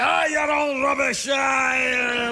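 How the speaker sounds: shouting Scottish drill instructor. Retold or imitated. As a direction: bark at the class like a gym sergeant. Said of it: "If I wanted to be yelled at".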